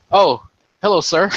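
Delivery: retold oops, then greet the officer; caught off guard. Medic suddenly noticing his XO behind him, caught off guard.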